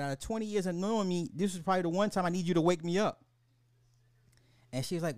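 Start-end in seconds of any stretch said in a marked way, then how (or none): 0.0-3.2 fast retold plea. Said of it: "I said".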